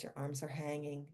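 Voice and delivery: ordinary talk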